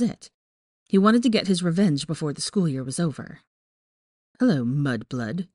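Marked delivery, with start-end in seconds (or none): 4.4-5.5 slow, lower drawl with contempt. A narrator's reading. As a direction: draw it out, sneering and superior. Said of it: "Malfoy drawled".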